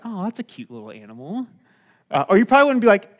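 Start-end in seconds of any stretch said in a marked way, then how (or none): 0.1-1.4 soft, low, oblivious aside; mock cooing. Pastor imagines a clueless gopher spotting a lion, mock cooing. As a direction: murmur it, as if naively charmed.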